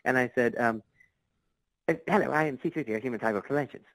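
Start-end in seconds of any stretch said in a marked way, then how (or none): 1.9-3.8 rushed nervous intro, higher; nervous, really fast. Retold or imitated. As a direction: blurt it out fast, like a nervous fan. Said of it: "You know, like, really fast".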